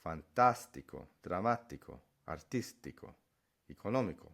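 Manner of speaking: "Fantástico, dramático, artístico, económico" punched on TÁS, MÁ, TÍS, NÓ; stress on accented syllable. Shown as a demonstration. Teacher demonstrating accent marks to learners, stressing each accented syllable. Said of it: "you actually put the stress a little earlier".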